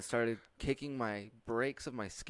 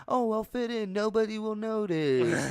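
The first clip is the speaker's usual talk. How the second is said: hammed-up English accent, pitched way up; mock English accent; 11 semitones higher, 4 dB louder than usual. Mocking someone trying to fit in over there, fake English accent. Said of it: "he has clearly an accent".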